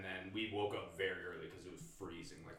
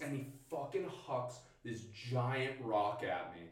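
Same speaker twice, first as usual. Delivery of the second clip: usual speaking voice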